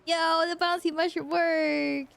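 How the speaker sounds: high, slow thrilled cry; delighted shout of success; excited. Real, not acted.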